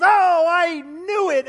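high, loud, slow exasperated outcry; exasperated friend. Retold or imitated. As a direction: ham up the friend's indignant gotcha. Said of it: "And she goes".

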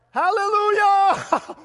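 high, long, drawn-out holler; jubilant shout. Retold or imitated. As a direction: belt it out in acted-out celebration. Retold joy at wallet found.

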